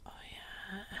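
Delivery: whispered oh yeah; hushed whispered aside. Real, not acted. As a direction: breathe it as an aside.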